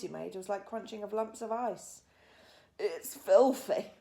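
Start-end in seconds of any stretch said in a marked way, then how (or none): 2.8-3.9 slow, loud spluttering through a mouthful; spluttering with mouth full. A narrator's reading. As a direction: spit the words through crunching food. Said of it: "he spluttered".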